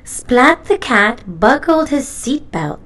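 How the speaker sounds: usual speaking voice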